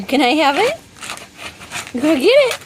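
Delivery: loud high urging; excited dog talk. Real, not acted. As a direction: hype the dog up loudly.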